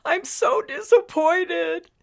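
crying it out to her husband; weeping. Acted. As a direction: grandmother weeping into a hug. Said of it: "She wept harder".

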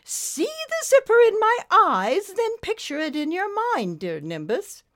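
high, commanding British lady; stately British coaxing, softening at the end; Lady Meander. A narrator's reading. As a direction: instruct grandly in a British accent. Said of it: "in a most British stately accent".

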